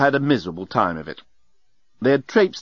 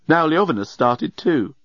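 plain reading voice

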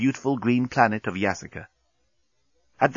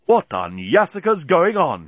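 slow, raised-pitch disbelief; baffled exclamation; 6 semitones higher, 3 dB louder than usual. A narrator's reading. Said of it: "he exclaimed".

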